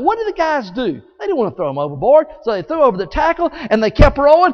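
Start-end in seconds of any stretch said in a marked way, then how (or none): none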